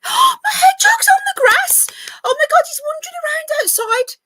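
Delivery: real burst of delight, high and loud; excited gasp. Real, not acted. Vlogger spots her hedgehog on the grass, thrilled squeal. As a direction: gasp and blurt out the news, thrilled. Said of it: "Sorry, I got a bit excited then".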